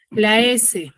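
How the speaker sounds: usual speaking voice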